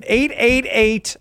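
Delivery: slow, high, stretched out numbers; slow and emphatic. Shown as a demonstration. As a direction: say each eight slowly and clearly. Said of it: "I gotta say it slower".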